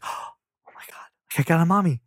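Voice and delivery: gasp, then whispered disbelief into voiced joy; hushed, overwhelmed gasp of a new mom; breathless whisper opening up on the last words; awed delight. Retold mom's awed reaction.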